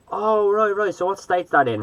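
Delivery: high-pitched mock of a clueless stranger; clueless American guy. Shop guy still not placing Ireland, imitated clueless. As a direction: imitate him, voice lifted well up.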